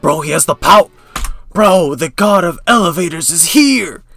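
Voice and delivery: loud, fired-up hype announcement; hyped shout. Real, not acted. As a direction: shout it like a fan losing it. Hyped reaction to trailer.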